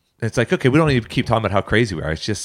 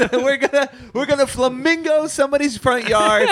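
put-on hyped youth group kid; 13 semitones higher than usual.

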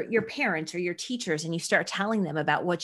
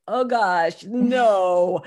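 mock dismay; 7 dB louder, much slower than usual. Mother reacting to kids' request.

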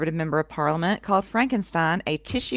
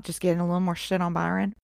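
usual speaking voice

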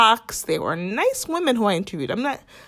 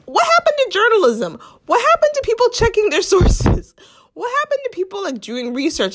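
high-pitched incredulous demand; indignant rhetorical rant, pitched way up; exasperated outrage; 12 semitones higher, 3 dB louder than usual. Acted. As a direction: cry it out high, appalled. Mock outrage at lazy journalists.

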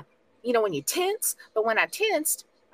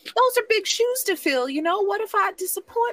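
put-on anxious young hero; 13 semitones higher than usual. Hero worrying to her famous father about big shoes, anxious.

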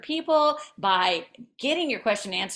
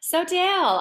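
host opens again, louder and higher; bright restarted intro; 8 semitones higher, 7 dB louder than usual. Shown as a demonstration. Host restarting the show intro, more upbeat. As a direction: kick off the question with fresh energy. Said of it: "starting over".

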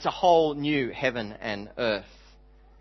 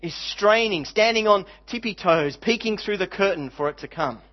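high, loud and emphatic sermon voice; raised pulpit voice, each phrase landing hard; impassioned preaching; 6 semitones higher, 5 dB louder than usual. Real, not acted. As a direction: preach it with soaring wonder. Preaches with rising excitement.

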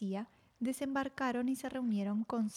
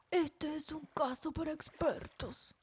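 put-on slow, higher wise old penguin; viejo pingüino sabio; 5 semitones higher than usual. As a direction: speak like the wisest elder penguin. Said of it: "dijo el pingüino más sabio". (pingüino sabio, voz lenta y aguda)

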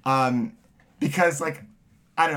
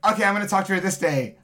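excited; 5 semitones higher, faster than usual. Retold or imitated. Excited plan quoted.

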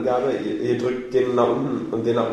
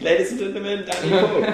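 high, grand announcer voice; showmaster announcement; 7 semitones higher than usual. Pretend. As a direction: present him like a gala host. Jokingly introduces co-host like showmaster.

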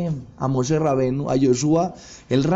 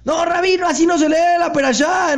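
high, loud scolding shout at the rabbi; outraged congregation shouting him down; crowd shouting; 13 semitones higher, 4 dB louder than usual. Retold or imitated. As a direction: yell the correction from the pews. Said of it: "cómo todos empiezan a gritar al rabino".

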